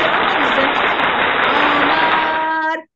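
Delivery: name held out long and loud; long loud call. Real, not acted. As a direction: call the name out as if across a room. Teacher calling child to answer.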